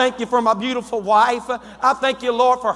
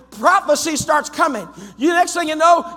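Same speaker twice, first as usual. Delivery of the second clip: ordinary talk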